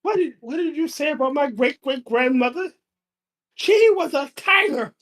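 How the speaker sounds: high-pitched mock outrage; offended cat. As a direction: play a cat defending the family honor. Acts cat offended for ancestor.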